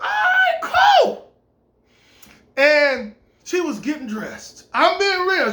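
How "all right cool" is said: squealing high, loud "all right, cool"; overjoyed yelp. Retold or imitated. To the landlady, a thrilled high-pitched shout. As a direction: burst out in giddy delight. Excited reply to landlady's offer.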